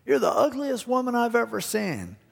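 high-pitched insulting parrot. Retold or imitated. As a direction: taunt it high, like a talking bird. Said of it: "He goes".

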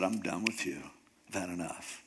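low, soft, weary dismissal; quiet finality. Acted. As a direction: drop the voice and give up on him. Holy Spirit gives up on Samson.

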